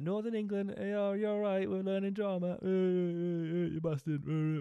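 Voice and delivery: high mock Northern English voice; hammed-up Northern English accent. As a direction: parody the Northern England kids. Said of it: "it's like".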